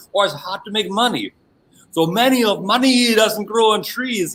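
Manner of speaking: high-pitched mock parental scolding; nagging parents. Parents telling a child to stop playing, mock scolding. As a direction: imitate parents laying down the law. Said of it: "So many parents are saying that".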